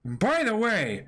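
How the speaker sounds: cheeky question. Acted. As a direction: ask it innocently.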